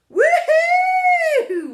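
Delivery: very high, very loud scream of joy; joyful whoop. A narrator's reading. Friends flying over the city, whooping with joy. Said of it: "we all scream super loud".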